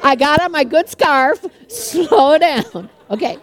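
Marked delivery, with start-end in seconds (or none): 1.7-2.9 "Slow down" stretched long on "Slow"; comically drawn out. Shown as a demonstration. Fast-talking preacher reminding herself, "Slow down" comically stretched. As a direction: drag out "Slow" in "Slow down" for a laugh.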